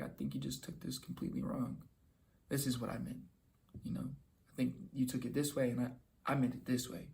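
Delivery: softly calming down an offended person; calm, soft explaining. Shown as a demonstration. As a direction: gently clear up the misunderstanding. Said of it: "calm voice, calm demeanor".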